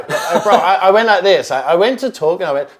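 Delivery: laughing through the words, high and loud; can't stop laughing while telling it. Real, not acted. Recalling freezing on camera, laughing.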